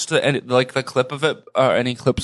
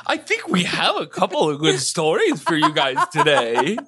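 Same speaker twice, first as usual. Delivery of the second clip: put-on host voice; 9 semitones higher than usual.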